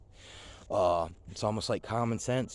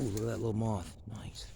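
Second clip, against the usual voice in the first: ordinary talk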